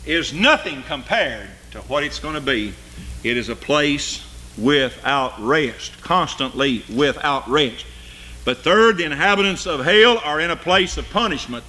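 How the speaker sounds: ordinary talk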